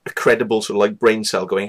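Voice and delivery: ordinary talk, nothing marked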